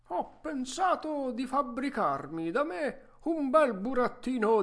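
vecchietto arzillo che espone il suo progetto; Geppetto entusiasta. Acted. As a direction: voce caricata, con slanci acuti e forti. (vecchietto pieno di entusiasmo)

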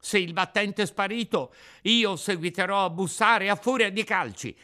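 furious puppet at the door; angry shout. Acted. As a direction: vow to kick the door down. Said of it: "accecato dalla collera".

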